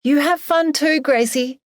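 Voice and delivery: high, singled-out call to the dog. A narrator's reading. Emily sends the dog off, calling out. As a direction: call it high across the distance.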